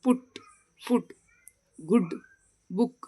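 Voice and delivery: ಪುಟ್, ಫುಟ್, ಗುಡ್, ಬುಕ್ spaced out, each with short "oo"; short "u" demonstration. Shown as a demonstration. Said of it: "ಹೂವನ್ನು ಹೆಂಗೆ ಓದ್ತೀವಿ ಅಂದರೆ".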